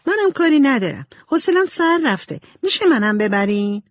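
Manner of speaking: high, pleading little-sister voice. A narrator's reading. Bored little sister asks to tag along, pleading. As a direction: beg to be taken along, pitched high.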